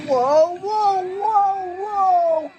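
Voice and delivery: high cries of alarm; string of high, drawn-out yelps; alarmed whoas. Acted. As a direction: wail whoa over and over, pitched high. Car heading for a cat.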